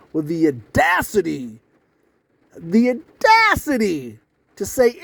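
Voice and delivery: slow, hammered mock indignation; mock outrage. Newcomer mocking how the men treated him, indignant. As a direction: blow the key word up, stretched and high.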